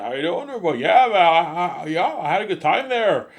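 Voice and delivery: high, loud put-on Canadian voice; hardcore Canadian accent. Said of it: "they have their hardcore Canadian accents".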